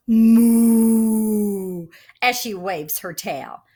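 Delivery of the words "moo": long, loud drawn-out moo; big sustained cow moo; brown cow. A narrator's reading. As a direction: moo loudly and hold it. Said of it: "The brown cow says".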